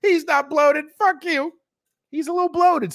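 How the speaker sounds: high, loud mock fan whine; hammed-up fan defending his idol; mocking defensive fans. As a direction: squeak it like an outraged superfan. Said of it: "the fucking fans going".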